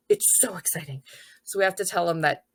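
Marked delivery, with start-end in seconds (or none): 0.1-1.0 put-on whispered, breathy kid gush; mock whisper. Kindergartener whispering how exciting it is. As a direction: breathe it like a secret thrill.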